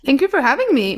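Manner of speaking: talking through a mouthful; words round a bite of food; mouth full. Real, not acted. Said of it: "My mouth is full".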